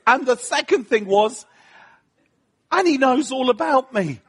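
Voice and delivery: overwhelmed with wonder, laughing through it; excited awe. Retold or imitated. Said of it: "I was like".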